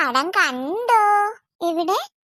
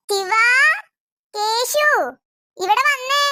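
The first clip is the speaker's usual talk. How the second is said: calling out; 6 semitones higher, much slower than usual. Acted. Mother calling her children to come. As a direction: shout for them to come.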